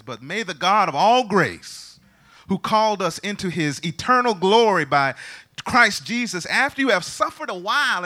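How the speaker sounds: loud, high, ringing scripture reading; preached scripture. Real, not acted. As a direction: proclaim the verse at full force, stretching words. Reads scripture at full force.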